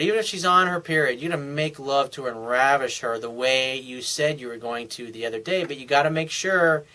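ordinary talk

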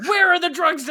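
high, loud imitation demand; mock angry demand. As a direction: play the interrogator at yelling pitch. Retold quarrel over missing drugs.

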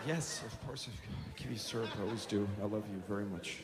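very soft, low, subdued reply; hushed, weary parental patience; placating dad. Retold or imitated. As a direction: answer the tyrant meekly and gently.

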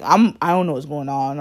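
ordinary talk, nothing marked

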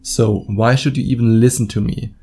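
ordinary delivery

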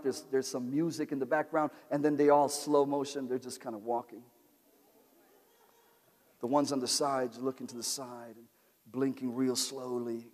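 soft and low, drifting and dreamy; hushed storytelling. Acted. Preacher painting a film scene, soft and low. Pictures disciples in slow motion.

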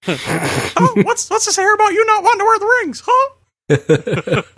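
high, fast cartoon squeak; squeaky mocking voice.

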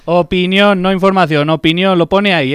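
insistent. Real, not acted. Co-host insisting in argument.

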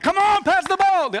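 high, loud hammed-up holler; sideline dad yelling. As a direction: yell like an overinvolved soccer dad. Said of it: "I'm the one that's yelling".

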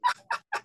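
sudden squeaky burst of laughter; high laugh. Real, not acted. Teller cracks up over the babysitter saying nothing, high laugh.

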